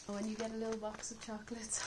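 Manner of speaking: normal talking voice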